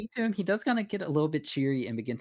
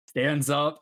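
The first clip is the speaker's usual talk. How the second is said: normal talking voice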